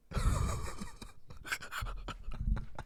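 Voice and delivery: long, soft wheezy laugh; real laughing fit. Real, not acted. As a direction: laugh helplessly at your own story. Laughing at own retold scene.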